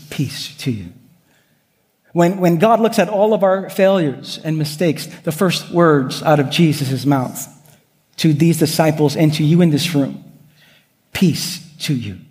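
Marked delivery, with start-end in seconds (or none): 0.1-0.9 soft and low, gently falling; a quiet comforting blessing; tender blessing. Acted. God's grace instead of judgment. 11.1-12.2 soft and low, gently falling; a quiet comforting blessing; tender blessing. Acted. God speaks to the congregation.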